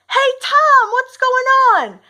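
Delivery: high, loud, slowed greeting called back. Retold or imitated. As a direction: sing out a cheerful hello across the yard. Calling back to boss.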